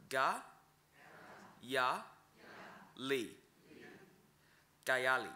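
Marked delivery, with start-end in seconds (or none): 0.1-3.3 "ga, ya, li" split into separate syllables with pauses. Shown as a demonstration. Teacher breaking down "ga, ya, li" for learners. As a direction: sound out "ga, ya, li" one syllable at a time.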